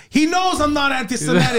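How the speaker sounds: mock-exasperated self-defense; indignant protest. Retells protesting to girlfriend's apology.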